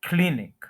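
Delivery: Clinic as "KLIN-ik", falling after a strong start; first-syllable stress. Shown as a demonstration. Teacher showing where stress falls, stressed pronunciation. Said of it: "first के ऊपर stress है".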